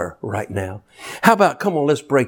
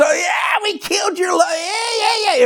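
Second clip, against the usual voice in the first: very high, crying shout of demons; gloating demons. Acted. Preacher voicing demons to the church, wailing gloat. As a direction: crow and wail like mocking devils.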